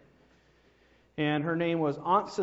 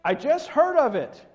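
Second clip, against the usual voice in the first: higher, louder and slow shout over the shoulder; yelled back; 9 semitones higher, 10 dB louder than usual. Acted. Sam yells back to the man, slow and loud. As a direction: yell it back while running off. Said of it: "And he yelled back".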